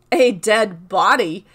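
loud, slow exclamation of feigned astonishment; affected amazement. A narrator's reading. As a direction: exclaim it loudly, drawing it out in mock amazement. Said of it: "exclaimed the robber with affected amazement".

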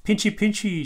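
high, loud singsong "pinchy"; playful pinching. Acted. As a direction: play a pinching crab for fun. Bluey pretends to pinch.

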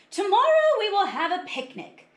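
high, loud excited announcement; teacher's announcement. A narrator's reading. Teacher announcing a picnic to the class, excited announcement. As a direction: announce a treat to the whole room. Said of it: "Miss Pimento made an announcement".

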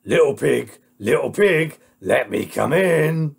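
loud, gruff big bad wolf; menacing wolf. Wolf calls to the little pig, loud and menacing. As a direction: demand entry as the storybook wolf. Wolf calling at second house.

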